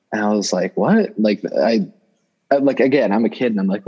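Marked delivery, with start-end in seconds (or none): 0.8-1.2 put-on sharp high startled "What?", a kid's shock. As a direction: jump up in pitch as if caught off guard. Kid hearing about a gunshot.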